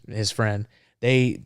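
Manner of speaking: plain reading voice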